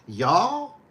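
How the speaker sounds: slow, tentative Kentucky "y'all"; unsure imitation of Kentucky speech; attempted Kentucky drawl. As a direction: try a stretched-out Kentucky twang, questioning. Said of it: "what do you say down in Kentucky".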